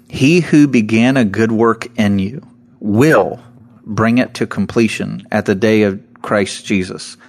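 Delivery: "will" set apart by pauses; stress on will. Shown as a demonstration. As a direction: land hard on "will", then pause. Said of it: "the emphasis on the word will".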